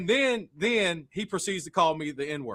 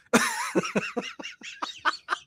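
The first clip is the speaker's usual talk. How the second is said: high, breathless laugh; breathy laugh; 6 dB quieter than usual. Real, not acted. Laughing at the wheat quote, high breathless laugh. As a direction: laugh silently.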